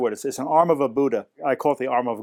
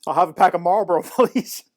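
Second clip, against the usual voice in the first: higher, louder needling request, ending on a high, laughing please; cheeky mock politeness; 5 semitones higher, 4 dB louder than usual. Narrator copying the refused customer to provoke the owner, cheeky. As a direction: tease with an over-polite order.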